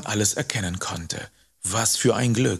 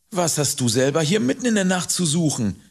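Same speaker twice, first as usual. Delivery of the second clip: mildly grumpy, higher-pitched question; 6 semitones higher, 3 dB louder, faster than usual. A narrator's reading. Boy questions rider in the middle of the night, grumpily. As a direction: ask it with a touch of sullenness. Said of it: "fragte ich mürrisch".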